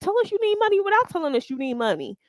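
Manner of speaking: high, sarcastic jab, then fast; mocking disbelief. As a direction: scoff at her like she's shameless. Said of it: "I'm like".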